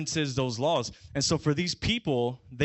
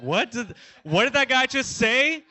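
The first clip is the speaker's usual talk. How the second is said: loud, high-pitched incredulous question; shocked disbelief; 9 semitones higher, 5 dB louder, slower than usual. Acted. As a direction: blurt it out higher and louder, stunned. Startled listener reacts to Jesus' words.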